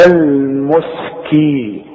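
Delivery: "المسكي" very slow, thin m and s; light m and s. Shown as a demonstration. Teacher shows the student, very slow light "المسكي". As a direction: stretch "المسكي", keeping it light. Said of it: "مرققة الميم والسين إزاي".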